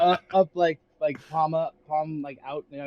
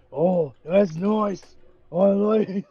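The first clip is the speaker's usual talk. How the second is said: imitating the voice; 4 semitones higher, much slower than usual. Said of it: "whoever did that voice".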